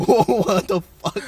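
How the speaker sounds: slow, drawn-out disbelief; slow stunned. Retold or imitated. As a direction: drag it out in shock. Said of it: "And I was like".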